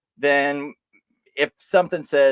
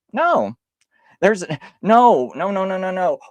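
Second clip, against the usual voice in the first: higher, louder string of flat refusals; emphatic no; 7 semitones higher, 6 dB louder than usual. Real, not acted. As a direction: shut the idea down hard. Heated objection in argument.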